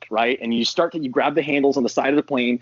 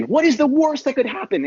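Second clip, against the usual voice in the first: high mock-alarmed ask; 12 semitones higher than usual. Shown as a demonstration. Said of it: "to just simply say".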